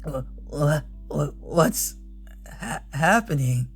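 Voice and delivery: slightly hushed broken stutter; weak, frightened stammer. Acted. Rider collapsing from the chill, weak stammer. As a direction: stutter as if your body is seizing up.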